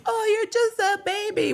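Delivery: put-on squeaky voice teasing him as a baby; high mock baby talk. Teasing him for being younger, in a high cooing baby-talk voice. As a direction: coo it like to a baby.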